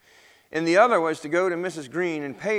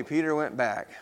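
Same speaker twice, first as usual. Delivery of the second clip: ordinary delivery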